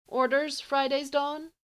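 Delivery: high, crackling, confused question; crackly voice. A narrator's reading. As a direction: voice crackling like static. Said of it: "Her voice is becoming more crackly".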